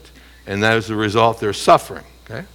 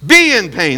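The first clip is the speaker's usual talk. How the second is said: "Be in pain" ordered louder and higher; commanding; 6 semitones higher, 6 dB louder than usual. Shown as a demonstration. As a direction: bark it out as a command. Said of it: "That's a command".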